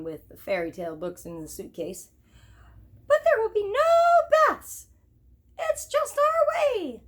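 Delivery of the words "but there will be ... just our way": very high, slow squeaky mouse voice; slow, high royal squeak; Mouse King decreeing. Acted. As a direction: lay down the law as a tiny king. Mouse King continues his decree.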